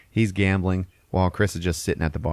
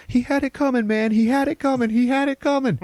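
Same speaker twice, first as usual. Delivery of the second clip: very high-pitched frantic yell; panicked mock yelling; 3 dB louder than usual. Passenger about to be beaten, mock panicked yelling. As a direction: imitate a man yelling to save himself. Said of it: "immediately starts yelling".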